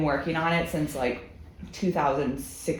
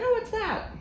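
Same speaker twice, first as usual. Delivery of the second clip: surprised. Retold or imitated. Retold clueless reply, very high.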